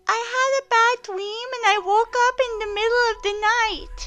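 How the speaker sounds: put-on high cutesy little boy voice; squeaky baby-talk kid; uwu voice. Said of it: "do this in an uwu voice".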